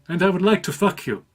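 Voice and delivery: high, loud imitated Indian accent; mock Indian accent, pitched well up. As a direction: put on a loud, rough-and-ready Indian accent. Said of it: "I'm gonna do an accent".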